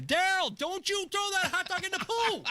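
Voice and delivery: mock mom yelling at Daryl; mom yelling across the pool. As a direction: call out like a mother scolding her kid from the deck.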